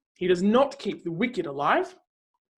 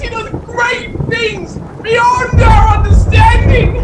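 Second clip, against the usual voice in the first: thunderous proclamation; 10 semitones higher, 15 dB louder than usual. Acted. Reader proclaiming God's roaring voice to the listener, full-force shouting. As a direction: preach it at full force, loud and high, shouting over the storm. Reading builds to the storm.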